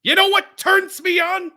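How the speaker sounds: very high, loud, slow hammed-up promo shout; imitating a wrestler's yell. Co-host re-enacting what startled him on air, in a loud mock wrestler yell. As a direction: holler it like an over-the-top wrestler. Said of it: "he was like".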